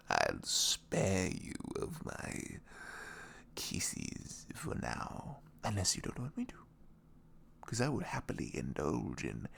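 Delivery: hushed playful tease; whisper. Real, not acted. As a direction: whisper it close and teasing. Teasing partner about kisses.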